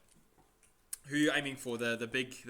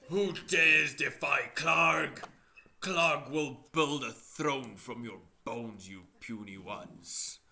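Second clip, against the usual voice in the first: slow booming monster voice; deep rumbling voice; 6 dB louder, slower than usual. Acted. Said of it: "this deep rumbling voice echoing through the cave".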